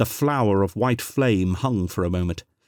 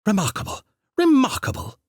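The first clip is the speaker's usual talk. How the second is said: enthused; 14 semitones higher than usual. A narrator's reading. The Doctor marvelling at the ancient power, enthused. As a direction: gush with delight at the machine. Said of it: "enthused the Doctor".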